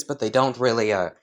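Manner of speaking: ordinary delivery, nothing marked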